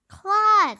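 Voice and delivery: high, loud call of his name; sharp call. Acted. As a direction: snap his name to stop him. Sister calling out sharply.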